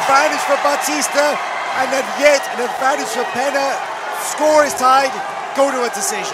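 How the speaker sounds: high, loud excited call; excited commentary. Real, not acted. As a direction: announce it fired up. Calling the final advantages.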